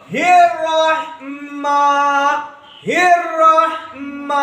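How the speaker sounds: हिर्रहमा chanted slowly, ending on a long open "maa". Shown as a demonstration. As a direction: stretch हिर्रहमा and stop without any final n. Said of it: "मा पढ़ेंगे". (हिर्रहमा को खींचकर, आख़िर में लंबा "मा")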